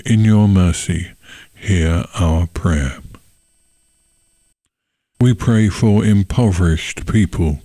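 ordinary talk, nothing marked

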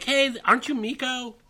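playful mock greeting. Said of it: "I'll be like".

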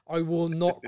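ordinary talk, nothing marked

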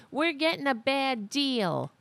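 high whiny mock voice; mocking Greene. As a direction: sneer it like a greedy whiner. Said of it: "She's like".

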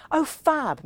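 high, sing-song gush; trilled. Acted. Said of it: "Millie trilled".

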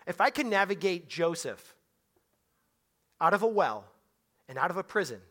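usual speaking voice

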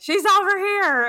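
high, loud mock dad call; playful high imitation of dad. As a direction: pipe up like dad spotting her first. Mother voices dad at airport.

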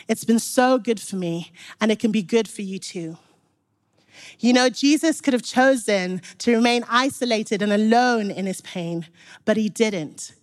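ordinary talk, nothing marked

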